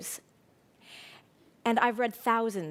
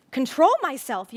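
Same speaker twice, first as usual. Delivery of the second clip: loud, incredulous retort; indignant yell; 3 semitones higher, 7 dB louder than usual. A narrator's reading. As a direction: throw the words back in outrage. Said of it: "yelled Fern".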